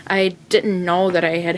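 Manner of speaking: ordinary delivery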